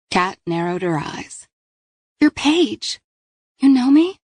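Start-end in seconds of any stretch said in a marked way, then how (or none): 2.2-4.2 put-on high, loud surprised teen; surprised girl. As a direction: voice sudden recognition. Kat recognizes Paige.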